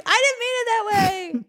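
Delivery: high, pleading protest; flustered half-laughing excuse; defensive whine. Retold or imitated. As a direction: backpedal in a squeaky voice. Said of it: "I'm like, like".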